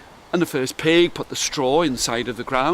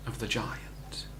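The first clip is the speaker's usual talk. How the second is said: soft whisper to close; whispered ending; 6 semitones lower, 15 dB quieter, slower than usual. A narrator's reading. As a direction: let the end fade to a whisper. Story ending hushed.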